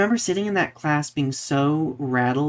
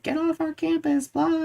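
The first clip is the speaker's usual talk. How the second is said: imitated protest. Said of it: "everybody else being like".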